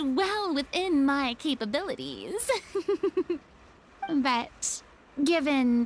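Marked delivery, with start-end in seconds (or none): 2.6-3.4 a short, proud little laugh, pitched up; light theatrical chuckle; haughty laugh. Acted. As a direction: laugh off the boast with airs. Furina boasts about her talents.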